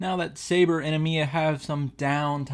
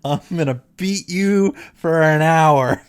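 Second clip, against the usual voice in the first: mock threat; 7 dB louder than usual. Voicing Saber's threat in game.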